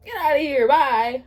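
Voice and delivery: mock dismissal. Real, not acted. Reader reacting to a romantic line, loud mock dismissal. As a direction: throw them out, loudly.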